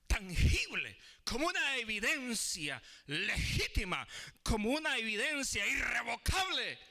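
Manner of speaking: high, loud-edged pulpit emphasis; forceful preaching. Real, not acted. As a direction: drive each phrase home like a preacher.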